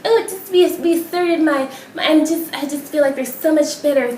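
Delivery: high mock whiny voice; sarcastic high-pitched imitation; mocking the pundit. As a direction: parrot him with sneering high pitch. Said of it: "he had the fucking nerve to double down".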